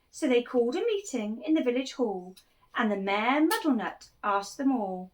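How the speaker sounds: put-on lively read-aloud for children; animated picture-book reading; storytelling voice. A narrator's reading. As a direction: tell it like a bedtime picture book.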